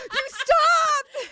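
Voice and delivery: high, loud mock gasped outcry; laughing while squealing the cop's shock. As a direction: play the startled cop for laughs. Cops rushing at a parked car.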